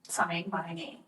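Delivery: flat, quiet monotone robot. Acted. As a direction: say it like a machine, no inflection. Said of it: "You just said it like a robot".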